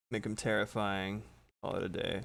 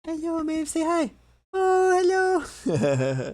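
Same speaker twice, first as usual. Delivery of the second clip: talking to a cat; 11 dB louder, slower than usual. Real, not acted. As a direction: squeal hello to the kitty. Greeting her cat again.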